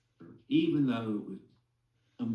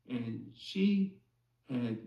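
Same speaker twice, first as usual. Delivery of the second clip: ordinary delivery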